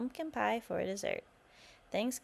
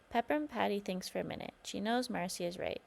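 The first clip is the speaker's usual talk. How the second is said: ordinary delivery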